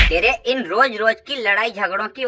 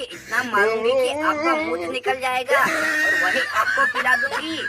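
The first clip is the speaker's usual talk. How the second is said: sweetly menacing. Acted. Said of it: "मैं प्यार से बोल रही हूँ".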